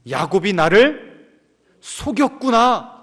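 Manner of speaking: slow, rising to a high cry of shock; dawning realisation. Acted. Old father realising his son's deceit, slow rising shock. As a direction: realise the betrayal. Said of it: "깨닫게 된 겁니다".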